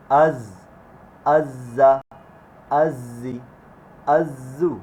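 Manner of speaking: "জা, জি, জু" said as a buzzing Z with air; airy z. Shown as a demonstration. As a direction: let air stream through the Z of "আজ্জা". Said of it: "এটাতে বাতাস আসবে". ("জ"-তে বাতাস দিয়ে উচ্চারণ)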